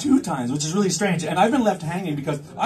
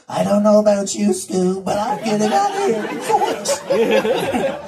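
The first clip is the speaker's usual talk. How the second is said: a light put-on slow drawl of a dull guy; lightly mock his slow way of talking; imitating his voice; 3 semitones higher, 4 dB louder, slower than usual. Mocking how the handsome audience guy talks, lightly voiced. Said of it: "I bet you he talks like this".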